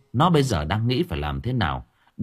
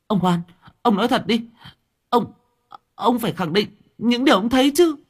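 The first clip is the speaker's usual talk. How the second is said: very high, fast insistence; desperate girl; 12 semitones higher, 3 dB louder, faster than usual. Acted. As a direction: demand he tell the truth. Lán begs again. (Lán van nài, giọng rất cao)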